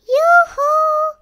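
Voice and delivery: high, loud singsong yoo-hoo; ringing, drawn-out high halloo; calling out. A narrator's reading. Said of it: "Winnie called again".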